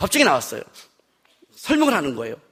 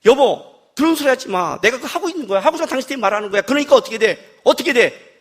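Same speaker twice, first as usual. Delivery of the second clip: exasperated retort; 4 semitones higher, 3 dB louder than usual. Retold or imitated. Husband defending himself to his wife, exasperated. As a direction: protest building to a high shout.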